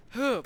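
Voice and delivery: high, loud pretend "huh"; short startled play-acted noise; mock gasp. Figures caught in the fight, mock gasp.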